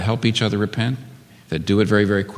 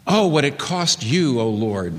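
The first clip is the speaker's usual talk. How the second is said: pleading lament for the wronged brother; imagined remorse for Abel; 9 semitones higher than usual. Pretend. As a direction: mourn the real cost, voice lifted and aching. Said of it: "Notice he is not saying".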